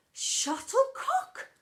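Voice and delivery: high, slow, disgusted. Acted. Drama teacher forcing out a hated word, slow and high. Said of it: "she could barely bring herself to say it".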